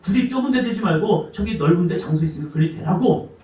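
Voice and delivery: ordinary talk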